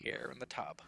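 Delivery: low, hushed mock-narrator; Morgan Freeman. Host finishing the tub joke in a bad deep impression. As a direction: softly imitate a deep-voiced actor. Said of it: "our bad Morgan Freeman impressions".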